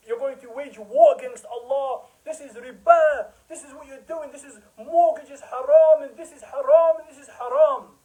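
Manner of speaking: mock a scholar wailing that it is all haram; mock emotional preacher. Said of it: "They cry and they're emotional and they say".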